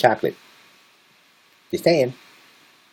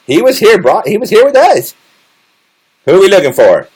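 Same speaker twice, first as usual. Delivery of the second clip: high-pitched mock protest; protesting alibi witness; 8 semitones higher, 4 dB louder than usual. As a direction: play a friend insisting on the alibi. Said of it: "y'all can all be my alibis and say".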